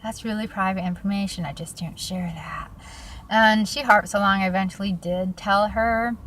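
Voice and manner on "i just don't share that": whispered. Real, not acted.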